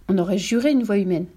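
usual speaking voice

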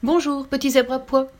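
lively tiger calling out; bouncing tiger. A narrator's reading. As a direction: bounce the hello like a leaping tiger. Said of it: "lançait le tigre en bondissant".

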